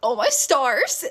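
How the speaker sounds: mock his reaction, stretching every word; hammed-up, drawling imitation. Repeats friend's phrase, drawn out, mockingly.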